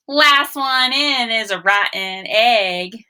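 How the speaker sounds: slow, lively read-aloud; story read to children. Acted. Teacher reading a picture book to the class, slow and lively.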